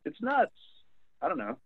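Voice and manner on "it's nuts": high, loud "It's nuts"; exclaiming. Real, not acted. Reacting to the flushable-wipes story, high and incredulous. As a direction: blurt it out in disbelief.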